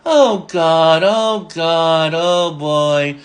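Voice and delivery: drawn-out imitated exasperation; Benny's disgust. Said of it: "shaking his head in disgust sometimes".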